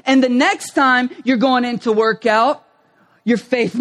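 ordinary talk

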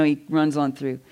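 ordinary talk, nothing marked

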